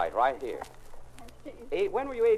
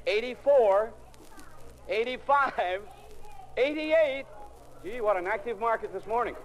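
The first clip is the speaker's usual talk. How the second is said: mock auctioneer calls; 7 semitones higher, slower than usual. Host auctioneers ages loudly.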